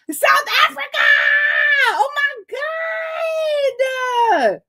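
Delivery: thrilled high cry; excited squeal. Real, not acted. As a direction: squeal with real joy at a faraway viewer. Excited shout-out to South Africa.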